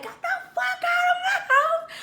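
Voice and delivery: very high-pitched squawk; furious retold yell. Woman throwing boyfriend's things out, high mock-furious yell. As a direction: act out throwing someone out.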